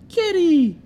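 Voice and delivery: high, loud call of Kitty. Retold or imitated. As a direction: call out to the little girl. Sulley's call quoted.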